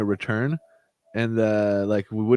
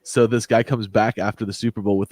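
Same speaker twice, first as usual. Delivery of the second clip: usual speaking voice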